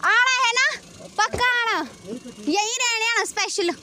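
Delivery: loud, eager farewell call; calling out goodbye. Real, not acted. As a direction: call out to them as they leave. Calling after departing family.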